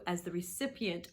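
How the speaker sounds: ordinary talk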